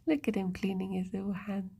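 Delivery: hushed whisper, barely any voice. Real, not acted. Whispering to her pet as he cleans his hands, hushed. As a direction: whisper so she won't disturb him.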